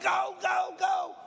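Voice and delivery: loud, high go go go; cheering shout. Retold or imitated. Preacher cheering his relay teammate on, shouting. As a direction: yell them down the track.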